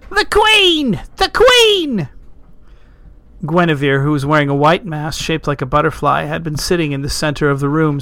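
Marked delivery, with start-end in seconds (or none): 0.1-2.1 very high, loud tipsy yell; drunken shout. A narrator's reading. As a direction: cheer it out like a tipsy reveller. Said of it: "someone who had undoubtedly had too much wine shouted".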